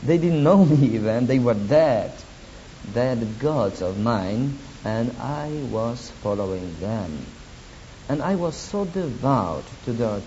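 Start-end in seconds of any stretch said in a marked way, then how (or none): none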